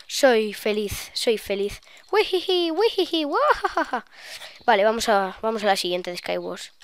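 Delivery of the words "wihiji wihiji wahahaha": high-pitched silly victory whoops; gleeful squeal. Real, not acted. Player saying she is happy after winning, gleeful squeal. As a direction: squeal and cackle with glee.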